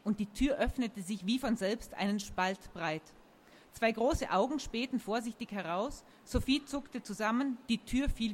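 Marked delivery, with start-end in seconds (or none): none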